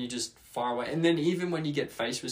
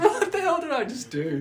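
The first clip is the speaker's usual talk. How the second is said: much higher, louder, disbelieving; stunned at himself; 5 dB louder than usual. Retold or imitated. Replaying his botched call, much higher and louder in disbelief. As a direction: gasp at your own blunder. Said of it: "I was like".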